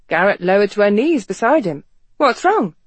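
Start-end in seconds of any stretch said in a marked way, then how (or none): 2.2-2.7 high alarmed question; worried, urgent asking. A narrator's reading. Alarmed woman asks.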